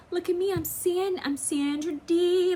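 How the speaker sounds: high, mocking prissy-girl voice; mocking goody-good girl. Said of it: "They were like".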